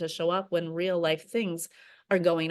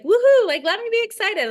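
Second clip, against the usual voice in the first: much higher and louder, squealed forced cheer; mock excitement; 9 dB louder than usual. Parodying herself forcing excitement for new clients, squealed. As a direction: overdo the fake excitement. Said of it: "And so it's like".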